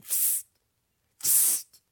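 whispered psst to get attention; soft, secretive hiss; hushed psst. A narrator's reading. Caleb calls under the tent, hushed psst.

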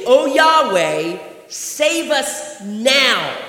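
loud, slow plea to Yahweh; urgent plea. Retold or imitated. Preacher voicing the crowd, slow urgent plea. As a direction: cry out the plea, drawn out. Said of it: "what they're saying is".

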